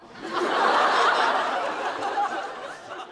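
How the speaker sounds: breathy falling laugh. Real, not acted. Picturing Thomas digging in the bin, breathy laugh. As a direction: dissolve into laughter.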